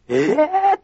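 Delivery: high surprised cry. Retold or imitated. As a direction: gasp it out in surprise. (驚いた声)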